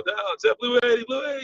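high-pitched quarterback cadence; imitated quarterback calling the play; quarterback's snap call. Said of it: "Kerry Collins, you know".

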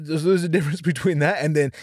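ordinary talk

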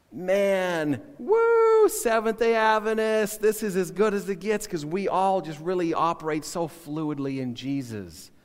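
loud, high mock enthusiasm, easing off toward the end; sarcastic cheering. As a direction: whoop it up in sarcastic self-congratulation. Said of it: "but I'm being sarcastic".

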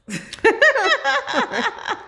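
high, loud laugh; high burst of laughter. Real, not acted. Joking about her husband.

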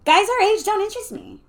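high, loud, protesting; flustered denial. A narrator's reading. Lucia denying her friend's guess, flustered and high.

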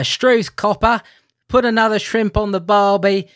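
hammed-up Aussie, loud and high; a clumsy fake Australian accent; mock Australian accent. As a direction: throw out a bad Australian accent for laughs. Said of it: "I'm just gonna get this out here and now".

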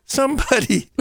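high, thin mock call for help; faint distant cry. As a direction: call as if muffled behind walls. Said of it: "you might very faintly hear".

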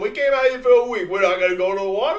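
high, loud, laughing wiseguy disbelief; hammed-up outraged mobster; mock mobster outrage. Arguing with partner, loud mock-mobster outrage.

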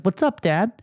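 lightly voiced cheeky little girl; imitated daughter. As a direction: greet Dad like a casual kid. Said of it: "and she's like".

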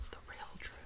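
hushed, whispered echo of a title; spooky whisper. Pretend. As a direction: whisper it like a secret.

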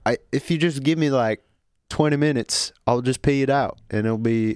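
pleading Southern son; Southern accent. Acted. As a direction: bargain with Daddy in a Southern accent. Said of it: "Southern accents?".

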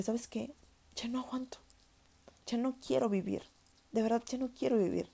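high, hushed, broken plea; despairing plea. Retold or imitated. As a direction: voice a desperate confession to mother. Retells begging mother, crying scene. (súplica desesperada y quebrada, en voz alta pero contenida)

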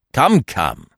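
brisk reproof; sharp. A narrator's reading. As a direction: cut in sharply. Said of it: "cried the detective sharply".

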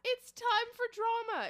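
high, loud, showy hype; hyped announcer. Pretend. Hosts mock tabloid promise, high loud hype. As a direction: sell the drama like a trailer.

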